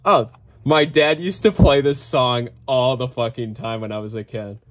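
ordinary talk, nothing marked

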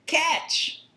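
high called-out shout; high shout across the cafeteria. Shown as a demonstration. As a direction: call out to a friend.

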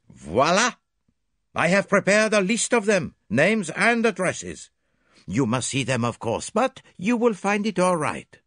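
put-on French accent, smug and precise; Poirot presenting his witnesses in a French accent. A narrator's reading. Voices Poirot.

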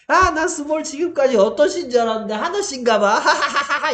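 높고 크게 떠들다 웃음 터뜨리기; 깔깔대는 학생을 과장해서 따라 하기. Retold or imitated. 웃으며 학생 말 흉내. (높은 목소리로 깔깔대는 학생 흉내)

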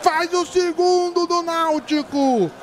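high, slow, shouted goal celebration; explosive goal commentary. Real, not acted. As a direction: keep yelling the goal, stretched out.

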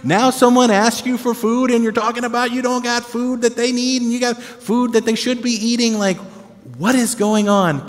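high, fast, disbelieving complaint; can you believe this guy; incredulous rant. Retold or imitated. Preacher ranting in mock disbelief at Jesus's riddles.

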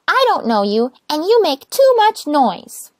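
little rosebud refusing to open the door, high and stubborn. Acted. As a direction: dig in your heels, high and prim. Rosebud complains.

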